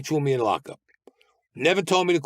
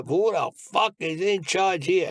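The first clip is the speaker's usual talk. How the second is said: mob boss bellowing; 7 semitones higher, slower than usual. Retold or imitated. Oh, I know that voice.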